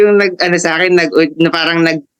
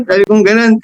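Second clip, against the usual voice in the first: ordinary delivery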